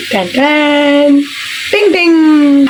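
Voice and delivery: high, loud sung fanfare; fanfare jingle. Pretend. Showing viewers the raita, a loud high ta-da fanfare. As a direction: announce the reveal like a game-show jingle. Presents raita as part two.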